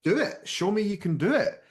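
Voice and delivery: pushy editor. Retold or imitated. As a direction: prod him like an impatient boss. Editor pushes the guest.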